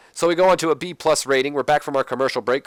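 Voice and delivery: normal talking voice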